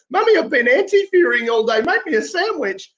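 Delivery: put-on high childish whine to mum, slowing at the end; hammed-up kid begging mummy; mock whiny protester. Mocking a masked rioter whining to his mum, high and childish. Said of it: "go back home to mummy and go".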